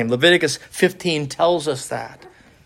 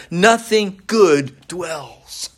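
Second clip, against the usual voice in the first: solemn drawn-out quote; much slower than usual. Acted. Quoting Paul's confession slowly.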